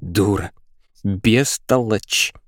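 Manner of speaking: drawn-out, contained fury; seething girl, insults stretched out; raging. A narrator's reading. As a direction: spit the insults slowly, word by word. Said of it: "бесилась девчонка".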